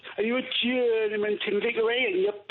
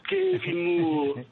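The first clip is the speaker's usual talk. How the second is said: mock Geordie; 8 semitones higher, much slower than usual. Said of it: "in that accent".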